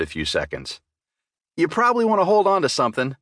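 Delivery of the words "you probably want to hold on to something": warning. Acted. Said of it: "I warned".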